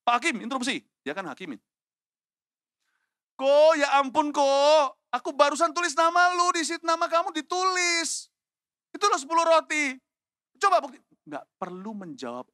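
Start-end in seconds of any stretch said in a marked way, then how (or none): 0.1-0.9 high, loud interrupting cries; urgent objection. Acted. Accused man objecting to the judge, high urgent shout. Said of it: "saya langsung interupsi". 3.3-11.0 very high, loud protesting wail; indignant self-defence. Acted. Younger brother defending himself with the bread, high loud protest. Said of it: "Kalau saya jadi Daud, saya langsung interupsi".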